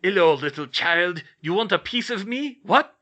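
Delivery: hammed-up high, loud movie villain; Bond villain voice. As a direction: camp menace, overplayed. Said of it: "exaggerated Bond villain sort of voice".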